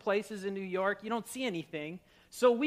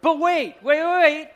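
loud, higher-pitched interjection; raised voice halting the room; calling for attention; 5 semitones higher, 10 dB louder than usual. Real, not acted. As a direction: cut in over the crowd's laughter. Halting the audience's laughter.

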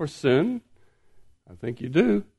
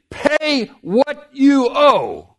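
demanding servant; 9 semitones higher, much slower than usual. Acted. Servant choking his debtor.

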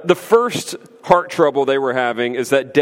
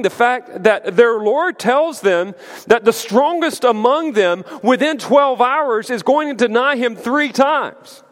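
normal talking voice

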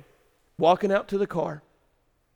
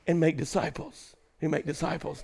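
sudden hush; 9 dB quieter than usual. Acted. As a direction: drop to a near hush after the shout. Sudden soft after shouting.

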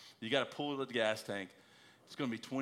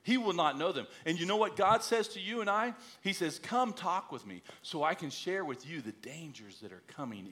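preaching; 5 semitones higher than usual. Acted. Exhorting about prayer.

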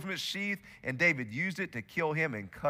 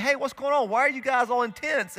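high, curious call of a newcomer; high-pitched surprised question; puzzled David; 7 semitones higher, 4 dB louder than usual. Retold or imitated. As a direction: ask it brightly, puzzled at the tension. David arrives, asks soldiers.

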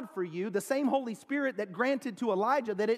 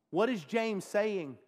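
ordinary talk, nothing marked

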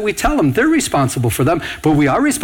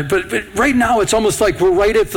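usual speaking voice

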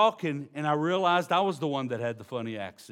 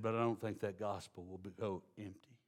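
quiet assurance; 7 semitones lower, 11 dB quieter than usual. Retold or imitated. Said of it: "He said".

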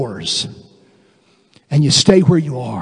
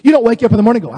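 ordinary talk, nothing marked

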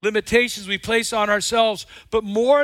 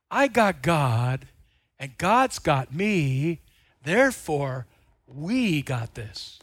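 sincere and slow; 7 semitones lower, much slower than usual. Real, not acted. Preacher reframing his confidence, slow sincere. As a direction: settle it calmly.

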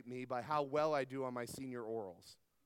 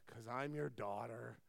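bored eye-roll reply; 7 semitones lower, 8 dB quieter than usual. Retold or imitated. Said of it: "rolls her eyes and goes".

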